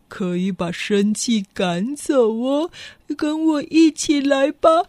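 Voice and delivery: very slow, low, easygoing hippo; a mellow hippo coaxing the cub along; the hippo gently calls the lion to come cool off. Acted. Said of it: "河马就说".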